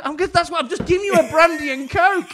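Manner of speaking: very high, loud mock protest; retold exasperated shout. Barman insisting he served the right drink, retold exasperated shout. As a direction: yell back in comic exasperation.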